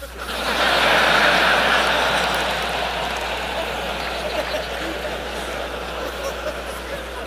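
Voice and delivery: long breathy laughter with the crowd. Real, not acted. Preacher laughing at his own joke with the congregation, long laugh. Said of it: "this is embarrassing".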